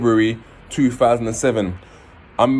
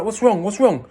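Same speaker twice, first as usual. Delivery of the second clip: alarmed question; 10 semitones higher than usual. Retold or imitated.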